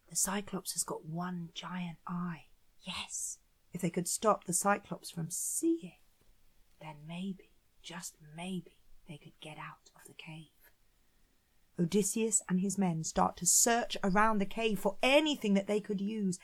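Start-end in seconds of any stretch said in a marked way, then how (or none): 0.1-10.5 hushed, much softer storytelling; hushed suspense. A narrator's reading. Narrator telling children how the men might escape the Cyclops, hushed. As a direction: let them in on a secret plan, very softly.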